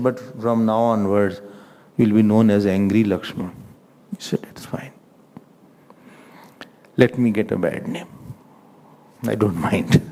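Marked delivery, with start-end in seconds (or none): 0.0-3.5 low, soft warning; subdued acted admonition; quiet caution. Acts Ram's quiet caution to Lakshman. 4.5-10.0 very soft, low resigned reply; hushed acted acquiescence; quiet acceptance. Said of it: "Lakshman ji was very quiet".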